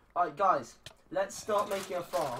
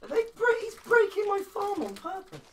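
high, loud outraged yell; outraged shout; 12 dB louder than usual. Real, not acted. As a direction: shout in disbelief. Teammate breaking his farm.

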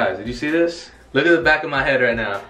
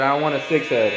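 ordinary talk, nothing marked

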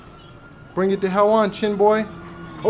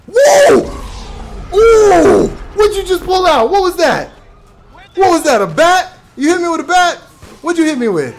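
startled shout; 8 semitones higher, 7 dB louder than usual. Real, not acted. Hit with a bat in-game.

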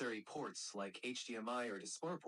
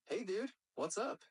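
higher-pitched Californian greeting; California accent; 7 semitones higher than usual. Shown as a demonstration. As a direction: say it like a California dude. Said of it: "And in California".

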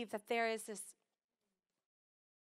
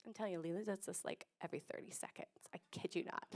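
whispered aside; 4 semitones lower, 11 dB quieter, faster than usual. Real, not acted. Aside about Lila.

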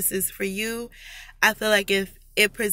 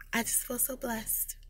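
soft breathy whisper of gratitude; 4 semitones higher, 13 dB quieter than usual. Real, not acted. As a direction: whisper it, full of emotion. Emotional thanks closing the video.

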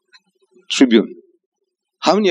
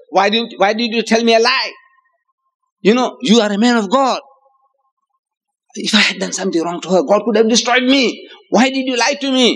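high-pitched, rising reproach of a cheated king; indignant king; 9 semitones higher than usual. Retold or imitated. King scolds Ibrahim for lying to him, in a high indignant voice. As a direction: scold him for the lie, climbing higher.

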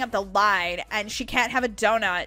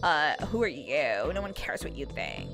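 contemptuous dismissal; 8 semitones lower, 5 dB quieter than usual. Acted. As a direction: dismiss them in a low, bored drag. Rude employee dismisses a child.

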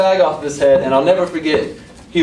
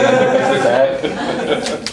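laughing; 3 semitones lower, much slower than usual. Real, not acted. Laughing mid-sentence.

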